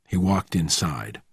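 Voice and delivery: ordinary delivery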